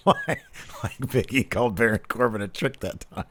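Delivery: talking through real laughter; words breaking up with laughter; laughing. Real, not acted. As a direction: laugh while getting the joke out. Joking about calling Santos a trick.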